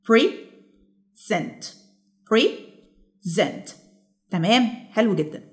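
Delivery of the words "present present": syllable by syllable. Shown as a demonstration. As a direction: present split as pre, pause, sent. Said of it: "الكلمة دي فيها كام syllable". (مقطّعة إلى مقطعين بينهما سكتة)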